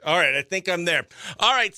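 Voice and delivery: usual speaking voice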